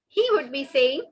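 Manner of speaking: ordinary delivery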